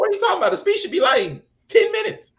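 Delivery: as if the idea is absurd; incredulous. Real, not acted. Arguing the speech length.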